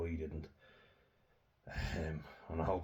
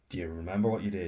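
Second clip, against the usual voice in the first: retold raised voice; 2 semitones higher, 7 dB louder, faster than usual. Confronting his brother's bully.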